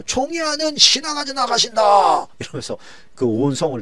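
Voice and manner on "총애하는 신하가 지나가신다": high, slow ringing proclamation; a herald calling out as he leads the horse; herald's cry. Acted. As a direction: announce it to the crowd. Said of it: "이런 거예요".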